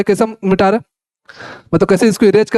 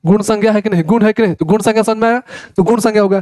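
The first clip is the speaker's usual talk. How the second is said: ordinary delivery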